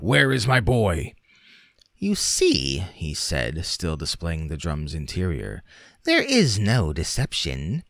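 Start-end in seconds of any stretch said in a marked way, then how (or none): 0.0-1.1 louder, higher anxious father; angry demand. A narrator's reading. Father confronting shopman. 2.0-2.8 much higher mocking showman; sly shopman. A narrator's reading. 6.0-7.8 much higher mocking showman; sly shopman. A narrator's reading.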